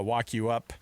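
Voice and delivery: ordinary delivery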